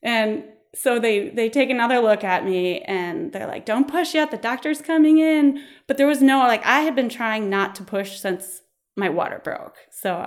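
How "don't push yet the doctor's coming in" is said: high, raised imitated nurse voice; mock-urgent nurses' call; urgent warning. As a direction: warn the patient urgently, pitched up.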